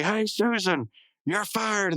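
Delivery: high, gleeful call; mock triumphant firing. Benson fires Susan triumphantly.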